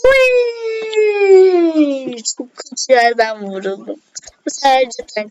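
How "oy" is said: high, loud yelp of dismay; pained cry. Real, not acted. Gamer yelping when her character gets shot. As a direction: yelp as if hit yourself.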